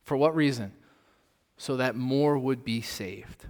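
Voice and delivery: usual speaking voice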